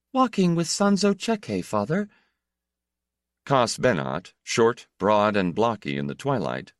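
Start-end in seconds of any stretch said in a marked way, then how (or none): 0.1-2.1 put-on high young girl voice, startled; startled daughter. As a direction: answer high and quick, caught off guard. Daughter answers father, startled.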